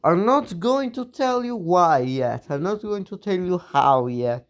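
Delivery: usual speaking voice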